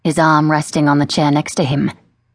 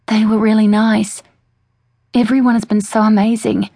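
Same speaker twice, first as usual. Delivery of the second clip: timid victim act; 5 semitones higher than usual. Acted. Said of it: "keeping with the timid victim act".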